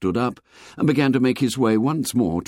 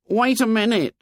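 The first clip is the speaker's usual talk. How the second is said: put-on high boy's voice calling; high-pitched call from the bushes; 14 semitones higher than usual. A narrator's reading. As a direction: call high for someone to wait. Hidden boy calls out again.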